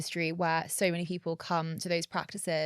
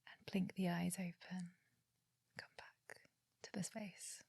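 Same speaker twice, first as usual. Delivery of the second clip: guided meditation; 7 dB quieter than usual. Acted. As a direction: calmly guide each breath. Leads a breathing exercise.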